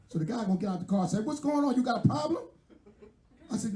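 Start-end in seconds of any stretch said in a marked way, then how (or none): 1.2-2.4 high, confrontational street guy; tough guy challenge. Retold or imitated. Young guy out of his car confronting the driver, high and challenging. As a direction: challenge him like you're ready to fight.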